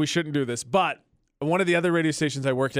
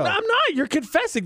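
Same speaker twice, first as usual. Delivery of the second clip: much higher mock-innocent protest; playful denial; 13 semitones higher than usual. Real, not acted. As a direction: tease back with pretend innocence. Denying accusation playfully.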